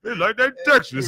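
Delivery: high-pitched mock Texas twang; mock Texas accent. Host teasing the guest's wording, high mock Texas accent. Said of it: "why you saying like that".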